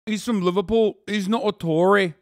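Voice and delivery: lightly put-on Liverpool accent; mock Scouse. Streamer guesses speaker's hometown, mock Scouse accent. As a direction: imitate a Scouser as you say it. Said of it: "That's a Scouser accent, right?".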